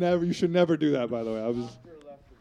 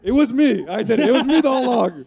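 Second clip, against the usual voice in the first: mock confession; 7 semitones higher, 8 dB louder than usual.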